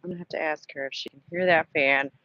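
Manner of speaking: ordinary talk, nothing marked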